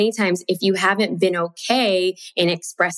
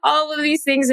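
high, fast distressed pleading; upset crying; 7 semitones higher than usual. Retold or imitated. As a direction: plead to the sky, near tears. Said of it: "I start crying".

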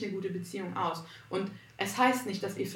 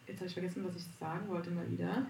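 trailing off; 9 dB quieter, faster than usual. Real, not acted. Forgets what she wanted to say.

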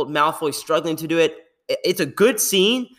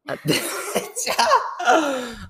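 laugh; 8 semitones higher than usual. Real, not acted. Laughing before mock apology.

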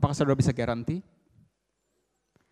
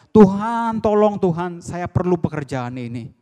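high, loud appeal to God; acted desperate call for help; pleading cry; 5 semitones higher, 7 dB louder than usual. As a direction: cry out in need, loud and raised. Worker crying to God for help.

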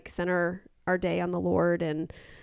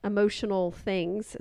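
ordinary talk, nothing marked

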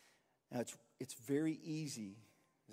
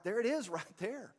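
delighted laugh; 8 semitones higher, 4 dB louder than usual. Real, not acted. Delighted at finding the verse.

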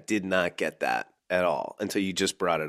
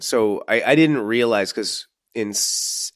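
usual speaking voice

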